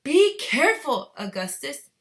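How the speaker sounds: high, loud warning call; shout. Acted. Father warning his son, shouting. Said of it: "shouted Mister Gloop".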